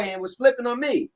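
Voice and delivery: ordinary delivery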